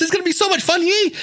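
high, gushing new podcaster; giddy excitement. Retold or imitated. New podcaster gushing to listeners, giddy and high. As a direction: squeal it like a thrilled beginner.

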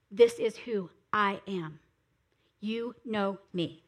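slow, low, hushed-down statement of identity; measured, weighty self-revelation; solemn declaration. Acted. Voices Jesus revealing who he is.

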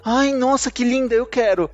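gushing over the beach photo, playfully; imitating his wife. (imitando a esposa, empolgada e mais aguda)

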